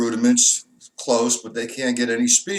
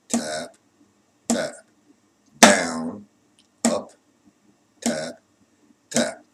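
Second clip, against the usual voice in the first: one word per beat, evenly spaced; calling out strokes; 4 semitones lower, much slower than usual. Shown as a demonstration. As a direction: call out each stroke in time, accented on the first. Calls each drum stroke in time.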